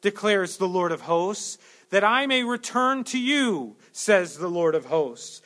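loud, slow, ringing proclamation; slow, loud pulpit reading of the verse; proclaiming scripture. Acted. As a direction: call it out as God's word.

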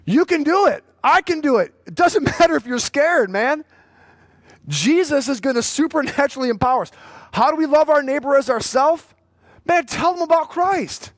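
rally the congregation; fiery preaching. Acted. Pastor urging church members to share the gospel, fired-up preaching.

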